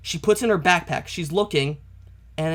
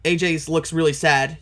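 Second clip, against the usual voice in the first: usual speaking voice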